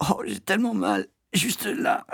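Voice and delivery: high-pitched pained whine; moaning.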